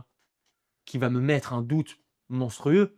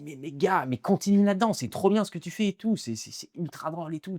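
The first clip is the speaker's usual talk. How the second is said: higher-pitched retold encouragement; imitated enthusiasm; 5 semitones higher than usual. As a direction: imitate a friend cheering you on, pitched up. Retells Colin's enthusiastic praise.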